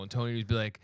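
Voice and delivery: normal talking voice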